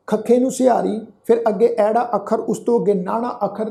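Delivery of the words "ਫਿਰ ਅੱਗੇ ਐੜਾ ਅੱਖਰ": usual speaking voice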